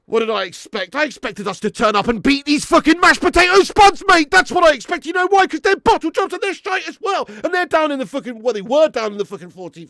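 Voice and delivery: shouting, loud and high-pitched, at full force; a fan who can't hold his fury in any longer; furious yelled outburst; real angry rant. Real, not acted. Fan ranting about his club's loss.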